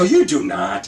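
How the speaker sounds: loud, mock-incredulous retort; retold disbelief. Man told of a warrant by officer he knows, retold disbelief. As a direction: push back loudly, as in a retold argument.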